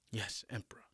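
hushed, humble whisper; whispered reply. Acted. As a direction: whisper it in submission. General answers the emperor.

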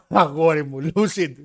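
a loud laugh into αγόρι μου, slowed. Real, not acted. To the co-host about WWE, laughing loudly. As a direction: burst out laughing at the idea.

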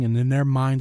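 normal talking voice

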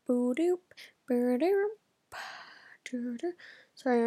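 ordinary delivery

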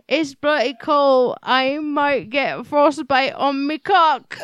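shaky-footed attempt at peasant British; drawn-out, badly faked British accent. Reader retrying the man's dialogue, in a badly faked British accent. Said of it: "I forget what accents are".

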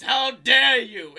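slow, high, hammed-up villain roar; screaming. Titan screaming at his son before the cart drops, theatrical roar. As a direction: stretch it into an outraged bellow. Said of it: "And then he screams".

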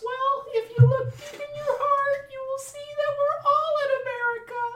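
very high squeaky sage; tiny mouse boy. Acted. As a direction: squeak it out as heartfelt wisdom. Mouse boy answers George.

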